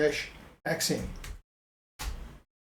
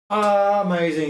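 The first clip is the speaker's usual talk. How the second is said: loud, high, drawn-out amazement; delighted exclamation; 5 semitones higher, 6 dB louder, slower than usual. Real, not acted. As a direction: stretch it out with showy delight. Delighted reveal of the finished result.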